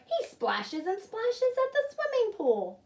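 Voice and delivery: put-on high, slow little-girl taunt; little sister voice. Little sister contradicting her brother, high and slow. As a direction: tattle like a small sister.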